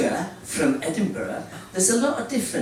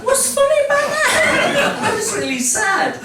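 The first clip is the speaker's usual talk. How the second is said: high, louder pretend hurt; hammed-up wounded protest; mock indignation; 5 dB louder than usual. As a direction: scold the crowd in jest. Mock hurt at laughing audience.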